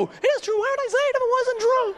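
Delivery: very high falsetto retort; mock a wife squabbling back shrilly high; arguing wife. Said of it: "pretending to yell".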